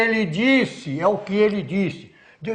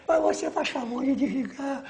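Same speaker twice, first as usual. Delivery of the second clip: high, soft, overly courteous plea; mock polite request; 7 semitones higher, 5 dB quieter than usual. Shown as a demonstration. General showing how he would not give orders, mock politeness. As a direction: imitate a meek, polite request. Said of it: "falou num tom de voz agressivo".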